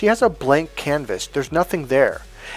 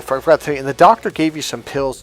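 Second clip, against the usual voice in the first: ordinary delivery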